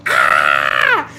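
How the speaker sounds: loud, low burst of excitement; a sudden delighted shout; excited yelp. Real, not acted. Reacts to viewer comment.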